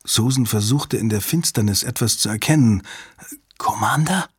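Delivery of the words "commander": hushed questioning whisper, rising. A narrator's reading. Susan whispering into the dark after a long silence, hushed question. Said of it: "flüsterte sie nach einer Weile".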